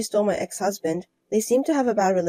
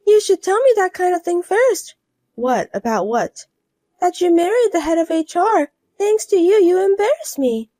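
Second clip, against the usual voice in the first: higher, louder complaint; higher voiced woman blaming her rival; reproachful rival wife; 9 semitones higher, 5 dB louder than usual.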